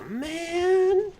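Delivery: high, loud, drawn-out whine; exasperated whine. Real, not acted. Gamer unable to afford the gun, high exasperated whine. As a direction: whine it high, then let it fall.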